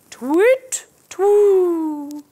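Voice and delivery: high hooting owl call; sing-song owl twit twoo. A narrator's reading. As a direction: hoot like an owl. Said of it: "he says".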